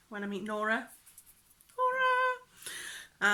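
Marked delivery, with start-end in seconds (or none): 1.8-3.3 very high, long-held call; playful calling out. Pretend. Vlogger pretends to greet her friend, high drawn-out call. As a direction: sing the name out high.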